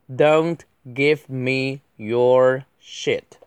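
very slow, every word separated; drawn-out word-by-word delivery; slow model reading. Shown as a demonstration. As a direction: space out each word for learners. Slow model reading, then faster repeat.

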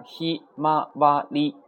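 ひまわり slowly, each kana held apart. Shown as a demonstration. Teacher counts out four kana, ひまわり syllable by syllable. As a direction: spell out ひ, ま, わ, り as separate beats. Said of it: "四个假名".

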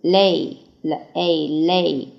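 ordinary talk

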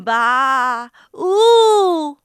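storybook elephant call, long and loud; elephant trumpeting. A narrator's reading. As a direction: trumpet like an elephant to scare someone. Elephant inhales, then trumpets to scare.

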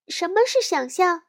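high curious little cub; little girl voice. Acted. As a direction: ask like a curious child. Sister asks.

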